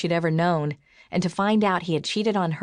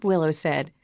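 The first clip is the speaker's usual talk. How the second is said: ordinary delivery, nothing marked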